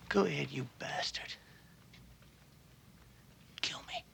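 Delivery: hushed, whispered dare; retold whispered defiance; defiant whisper. Defiant prisoner facing death.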